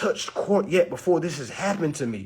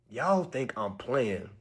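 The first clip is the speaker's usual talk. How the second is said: ordinary delivery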